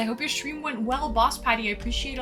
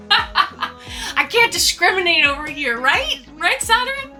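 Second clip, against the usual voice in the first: laughing; 6 semitones higher, 5 dB louder than usual. Real, not acted. Joking about Discord folk.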